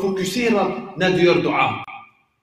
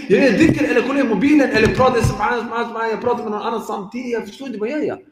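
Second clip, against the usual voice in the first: distracted worshipper; 6 semitones higher, faster than usual. Mock distracted man doing dhikr.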